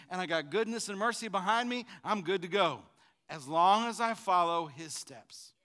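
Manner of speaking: usual speaking voice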